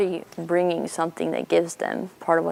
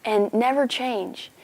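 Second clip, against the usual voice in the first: gushing Carol; 7 semitones higher than usual. Retold or imitated. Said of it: "And she's like".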